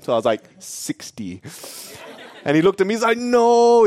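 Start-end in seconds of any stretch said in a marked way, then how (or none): 0.6-1.4 very low, hushed offer; quiet, deep deadpan bid; cool lowball. Retold or imitated. Child copies aunt's cool lowball. 3.2-3.8 high, loud-ish drawn-out refusal; mock-outraged haggler; protesting vendor.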